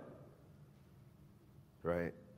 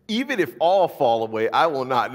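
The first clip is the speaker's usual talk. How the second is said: a lighter retelling of the boast, louder; Peter's boast; 5 semitones higher, 9 dB louder than usual. Acted. Preacher voicing Peter's vow to Jesus, mildly raised. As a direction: say it as Peter again, less pushed. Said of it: "This is what Peter says".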